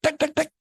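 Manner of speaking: sharp vocal door knocks, pitched high; knock-knock noise made by mouth; imitated knocking. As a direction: mimic rapping on a door. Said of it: "saya pintu, pintunya".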